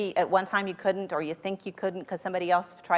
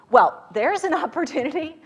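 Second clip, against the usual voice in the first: higher and louder, laughing through it; laughing optimism; 9 semitones higher, 6 dB louder than usual. Retold or imitated. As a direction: repeat it with a delighted laugh. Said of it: "she interpreted it as".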